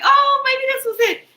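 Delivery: high, loud burst of hope; excited. Retold or imitated. Reader recalling her own excited hope at the card. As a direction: jump up in delight. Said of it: "I was like".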